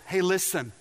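normal talking voice

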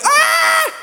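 very high mock scream; imitated scream. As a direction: shriek like a distant zip-line rider. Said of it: "I just hear screams".

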